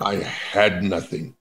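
slow, soft, melodramatic quote. Retold or imitated. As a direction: lightly play a dramatic old preacher. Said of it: "she talked very dramatically".

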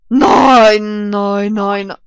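loud drawn-out wail of no; loud anguished no; frustrated cry. Real, not acted. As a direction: wail it as you lose. Dies in game.

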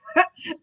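sudden high burst of laughter; real laugh. Real, not acted. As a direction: crack up mid-read. Reader breaks up laughing.

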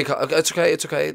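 usual speaking voice

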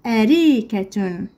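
"أريكة" carefully, joined into one word; slow whole word. Shown as a demonstration. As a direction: say "أريكة" slowly without breaks.

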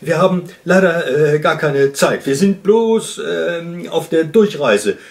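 loud, hesitant excuse; high shout broken by ums; calling back. A narrator's reading. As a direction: call back while fumbling for an excuse. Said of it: "rief der Luchs zurück".

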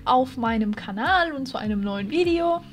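spooky-cheerful channel intro; youtuber intro. Acted. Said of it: "channel intro greeting".

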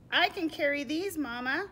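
high, loud little donkey voice; keen storybook animal offering help; eager young donkey. Acted. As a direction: pipe up to Mama, high and loud.

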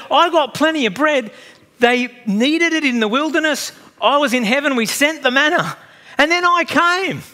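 a grand, exuberant Jesus declaring; proud Jesus. Retold or imitated. Jesus voiced boasting of bread.

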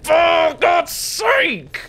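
high, loud, drawn-out angry yell; furious shout. Real, not acted. Gamer raging as the zombies corner him. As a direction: let the frustration explode. Enraged when trapped in game.

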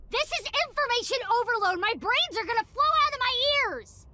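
fast, high frantic babble; overwhelmed and flustered; panicked overload. Acted. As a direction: rattle it off in a panic. Overwhelmed by sensory flood.

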